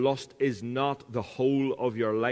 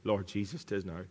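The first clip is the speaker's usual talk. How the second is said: ordinary talk, nothing marked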